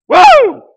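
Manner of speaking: a loud, high, joyful whoop; excited whoop. Real, not acted. Preacher whooping at his own point to the congregation, loud and high. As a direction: let out a real whoop of delight. Preacher exults over the message.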